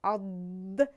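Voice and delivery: عد said low and firm; letter demo. Shown as a demonstration. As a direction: model the correct sound. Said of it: "حرف داد در تلفظش". (تلفظ درست حرف ضاد)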